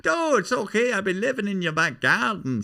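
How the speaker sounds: Tommy Nicholson. Acted. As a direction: reassure the star in a squeaky Irish comic voice. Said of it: "That's Tommy Nicholson".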